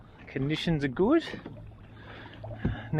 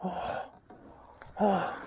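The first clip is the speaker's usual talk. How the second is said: ordinary talk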